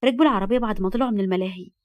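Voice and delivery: ordinary delivery, nothing marked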